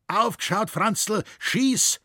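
high, loud cry of alarm; yelled dialect outburst, pitched high; old man's shout. A narrator's reading. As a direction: shout an urgent warning, then curse. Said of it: "schrie der alte Schrink".

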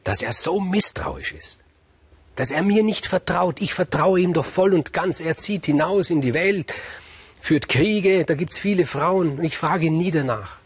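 high, loud, indignant queen; angry queen. Acted. The angry queen complaining about the king's mistrust. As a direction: rant it out in outrage at his mistrust. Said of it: "war zornig und hat gesagt".

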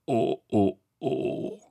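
short sobbing "oh"s; repeated woeful moans; moaning. A narrator's reading. Tete lamenting the broken hat, moaning. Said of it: "jammerte Tete".

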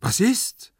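high, alarmed questions; frightened, high-pitched and urgent; trembling voice. Acted. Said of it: "frug Hyazinth mit zitternder Stimme".

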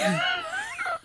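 squeaky, breathless giggle; a quick high giggle; high laugh. Real, not acted. Laughing at Jogurette.

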